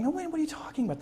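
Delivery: as if you can't believe your ears; incredulous. Retold or imitated. Bush asking his advisors about hackers, incredulous high-pitched disbelief. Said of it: "Bush is still sort of incredulous".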